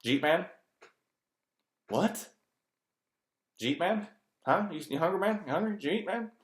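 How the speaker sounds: mock slurred questions, squeaky and rising; mumbled slurred speech. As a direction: imitate a local slurring words together, hammed up. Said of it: "who spews a sentence into a word".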